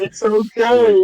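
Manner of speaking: slow, loud, high dazed reassurance; hammed-up stoned calm; spaced-out sous chef. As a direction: sing it out way too calmly. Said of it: "And she's like".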